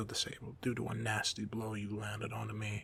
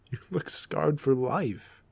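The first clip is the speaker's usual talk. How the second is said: laughing, slow and high; loud, amused jab with a laugh; amused teasing; 4 semitones higher, 6 dB louder, slower than usual. Acted. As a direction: tease them through a laugh, drawn out and raised. Villain laughs at embarrassed hero.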